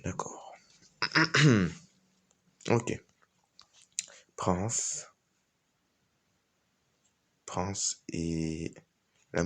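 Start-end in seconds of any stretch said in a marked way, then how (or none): none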